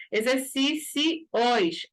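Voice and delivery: "Exercícios" drawn out slowly, opening the final "os"; slow sound demo. Shown as a demonstration. Teacher showing the class the ending sound, slowly. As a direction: stretch "Exercícios" and let the ending open. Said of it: "abre também o som do os no final".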